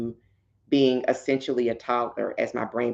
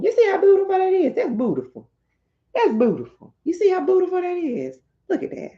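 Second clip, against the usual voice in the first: much higher, fast gushing over the artwork; gushing delight; faster than usual. Real, not acted. Showing viewers the book's pages, gushing high and fast. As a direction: squeal with delight at every page.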